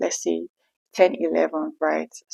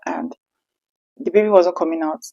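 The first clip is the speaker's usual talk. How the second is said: ordinary talk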